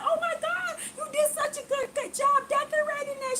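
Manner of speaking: high, gushing admirer. Retold or imitated. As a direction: pitch way up and gush over the decorating. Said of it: "She was like".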